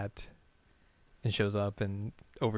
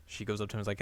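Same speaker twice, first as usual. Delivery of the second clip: usual speaking voice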